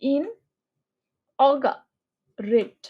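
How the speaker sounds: ইন, অগা, রেট split with clear pauses; slow chunked ইন অগা রেট; syllable by syllable. Shown as a demonstration. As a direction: break the word into three pieces. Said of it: "just খেয়াল করবা".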